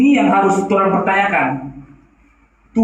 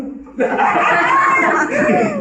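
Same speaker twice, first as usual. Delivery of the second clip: high burst of laughter. Real, not acted. Preacher laughs at the hypocrite story, high laugh.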